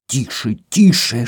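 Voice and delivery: ordinary delivery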